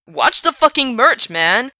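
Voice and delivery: high, loud yell. Acted. Alex yelling as a bottle hits the concrete, loud yell. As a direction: shout over the dropped bottle. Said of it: "Alex yelled".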